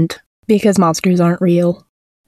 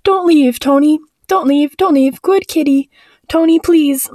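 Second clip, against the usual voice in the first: high, anxious begging; desperate pleading; 7 semitones higher than usual. Acted. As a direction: beg the cat in a high, urgent voice. Frightened child begs cat to stay.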